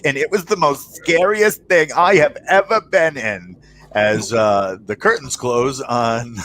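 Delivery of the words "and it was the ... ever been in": higher, lightly voiced rattled grandma; mock interview witness; imitating shaken grandma. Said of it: "she's like".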